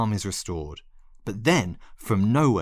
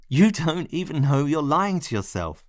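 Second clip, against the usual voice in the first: insistent pleading; 7 semitones higher, 3 dB louder than usual. Acted. Continued pleading argument.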